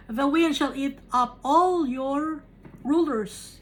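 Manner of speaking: solemn slow reading. Shown as a demonstration. Preacher reads the verse to the congregation, slow and solemn. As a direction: intone the verse slowly like a prophecy.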